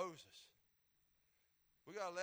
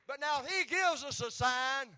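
loud, high preacher's whoop; rising sermon climax, shouted; preaching at full force; 7 semitones higher, 14 dB louder than usual. Acted. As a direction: drive it home like a revival preacher.

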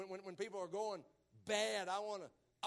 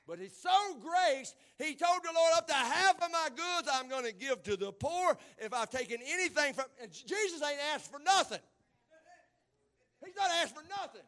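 louder, building preacher's cry; preaching at full force; 3 semitones higher, 8 dB louder than usual. Acted. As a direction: preach it loud and rising.

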